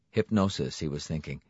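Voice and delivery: plain reading voice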